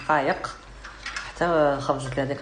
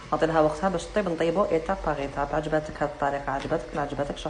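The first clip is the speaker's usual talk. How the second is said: usual speaking voice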